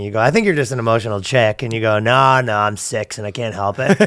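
ordinary delivery

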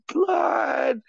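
a burst of real excitement, shouted; sudden shout. Real, not acted. Streamer hyping his crew, a sudden high shout. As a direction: blurt the word out as a high yell. Sudden exclamation mid-rant.

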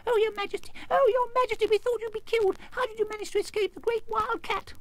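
very high, excited squeaky chatter; twittering little mice. A narrator's reading. Mice relieved to find their queen alive, twittering. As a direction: twitter like tiny mice in a fluster. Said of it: "asked in their twittering little voices".

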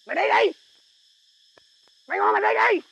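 loud, high yelling to get out; furious boss yelling at his sidekick; angry yell. Acted. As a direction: shout him out the door in anger.

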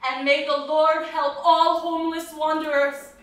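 loud, lifted plea to the Lord; loud pleading prayer for homeless wanderers; fervent prayer. Acted. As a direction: cry it out to heaven.

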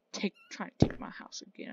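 hissed threat, barely voiced; menacing whisper. Whispering a threat at the villager in his house. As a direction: whisper the dare like a bully.

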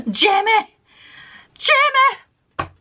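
high, loud, hammed-up calling; hammed-up Claire call. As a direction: cry out like a swooning heroine.

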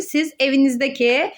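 ordinary delivery

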